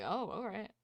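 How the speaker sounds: soft, sheepish backing down. Acted. Amalthea backs off from the men, soft and sheepish. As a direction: mumble it, caught out.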